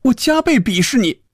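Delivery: high-pitched, emphatic mock scorn; theatrical high-voiced outburst; loud declaration. A narrator's reading. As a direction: proclaim it in a high, indignant voice. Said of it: "大声地说".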